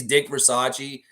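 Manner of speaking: Versace as ver-SAH-chee, three syllables; wrong pronunciation. Shown as a demonstration. Host quoting what everybody thinks, mispronounced. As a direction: say Versace like the fashion label. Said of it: "That's not how you say his name".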